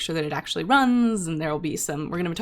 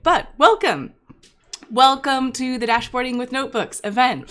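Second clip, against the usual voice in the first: excited welcome; 6 semitones higher, 5 dB louder than usual. Real, not acted. Greeting after tech trouble fixed.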